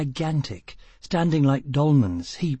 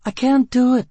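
high, loud, panicked cry; woman refusing to dive, panicked; frightened plea; 12 semitones higher, 7 dB louder than usual. Acted. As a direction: beg in terror of drowning. Gisbella panicking before the dive.

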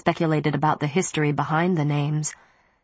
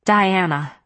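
mildly relieved greeting; 2 semitones higher, 4 dB louder than usual. A narrator's reading. Aunt greets her niece on the phone, relieved. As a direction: answer the phone with a touch of relief. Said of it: "Sarah sounded relieved".

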